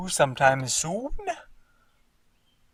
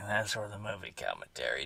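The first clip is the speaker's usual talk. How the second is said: much softer, muttered under his breath; quiet repeat; 6 semitones lower, 11 dB quieter than usual. Shown as a demonstration. Repeating the commentary aside to viewers, much softer. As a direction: drop to a hushed aside.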